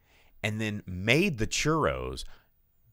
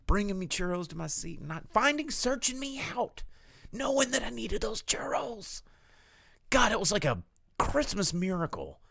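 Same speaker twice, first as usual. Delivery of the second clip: gushing; 11 semitones higher than usual. Real, not acted. Gushing about kindness.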